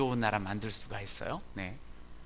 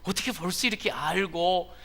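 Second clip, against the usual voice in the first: put-on high, loud startled kid; startled child; 6 semitones higher, 6 dB louder than usual. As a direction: blurt it out caught red-handed. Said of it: "애가 화들짝 놀라가지고".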